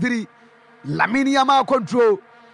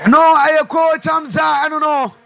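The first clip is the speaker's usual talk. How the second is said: much louder, higher outburst; excited shout; 3 semitones higher, 5 dB louder than usual. Real, not acted. Commentator reacting to the coach's side scoring, loud shout. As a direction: shout it to the booth in disbelief.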